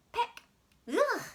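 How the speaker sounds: loud, high squawk; hen's squawk. Acted. Brown hen squawking at the medicine.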